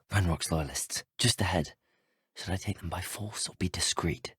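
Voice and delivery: low, hushed aside; stealthy undertone. Acted. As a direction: keep it down, enemies just ahead. Sneaking up on enemy loyalists.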